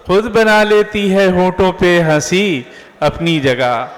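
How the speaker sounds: measured, emphatic verse with pauses; poetry recitation. Acted. Host reciting verses to introduce the poet, declamatory.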